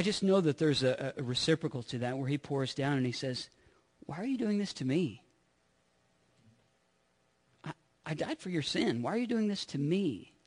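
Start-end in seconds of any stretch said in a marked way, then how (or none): 4.1-10.3 hushed, hurt plea as God; a pained, soft question from God; grieved God. Retold or imitated. God pleading with his people, hushed and hurt.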